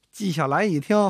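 plain reading voice

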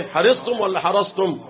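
slow, both readings of حرصتم; مقارنة بين نطقين لكلمة حرصتم، ببطء; contrast of pronunciation. Shown as a demonstration. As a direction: set حرصتم with the raʼ plain against حرصتم with the raʼ opened. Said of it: "حرصت بفتح الإيه؟ فتح الراء".

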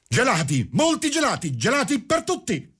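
madman's high-pitched jubilant proclamation; triumphant. A narrator's reading. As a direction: declare it in triumph, pitch soaring. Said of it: "disse il pazzo con aria trionfante".

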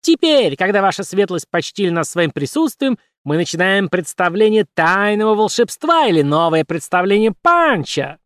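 high squeaky barker call; showman announcing. Acted. Puppet announces the show.

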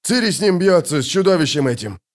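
urgent excited report; high-pitched exclamation falling off; alarmed cry. A narrator's reading. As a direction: warn them, starting high. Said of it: "закричал Локи".

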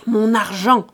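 loud, angry demand; fearsome growl. A narrator's reading. Old father drawn up tall demanding his money, fearsome growl. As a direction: thunder it out, short and forceful. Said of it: "gronda le vieux, terrible".

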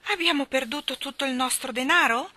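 ask, fearing the worst; anxious wife. A narrator's reading. Anxious wife fears ruin.

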